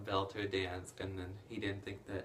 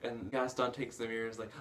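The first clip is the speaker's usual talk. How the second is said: ordinary talk, nothing marked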